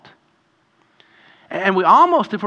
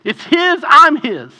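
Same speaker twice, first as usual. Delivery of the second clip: fervent exclamation; 10 semitones higher, 8 dB louder, slower than usual. Real, not acted. Passionate climax.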